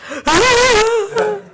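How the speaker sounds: high mock wail; acted crying. Distraught mother phoning her sponsor, mock wailing. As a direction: wail it out like a sob, hammed up. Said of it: "I'm calling her, and I'm crying".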